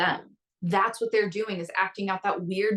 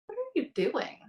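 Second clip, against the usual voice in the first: higher, gently curious; curious question; 9 semitones higher, 4 dB quieter than usual. Retold or imitated. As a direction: ask yourself with open curiosity. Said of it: "Full curiosity".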